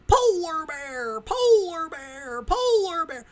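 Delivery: high, long-drawn holler from far off; Wild Bill screaming. Retold or imitated. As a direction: imitate an oddball yelling triumphantly in the distance. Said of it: "he's just screaming".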